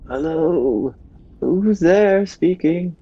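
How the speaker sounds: high, slow moaning zombie. Acted. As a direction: moan it like a clumsy zombie. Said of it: "in my best zombie impression".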